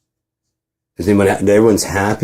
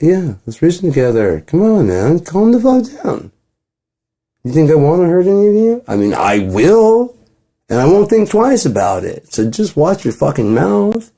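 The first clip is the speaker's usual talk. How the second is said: mock angry outburst; 10 semitones higher than usual. God snapping at complainers, a mock angry rant pitched high. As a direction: play the calm god suddenly blowing up, slowing on the threats.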